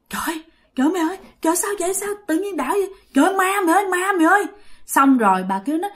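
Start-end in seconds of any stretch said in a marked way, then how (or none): 0.1-4.5 high panicked cries of a scared woman; retold, moderately high frightened wailing; frightened cry. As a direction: gasp it out, terrified of the ghost.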